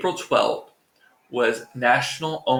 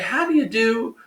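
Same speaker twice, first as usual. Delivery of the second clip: put-on a much higher, airy theatrical Moira accent on how do you do; grand, affected Moira Rose greeting, pitched way up; 10 semitones higher than usual. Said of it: "it's my own Moira accent".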